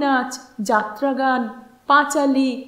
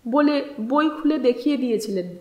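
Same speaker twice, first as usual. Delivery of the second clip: ordinary delivery, nothing marked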